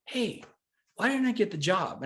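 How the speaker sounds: mildly pissed. Retold or imitated. Calling back a little pissed.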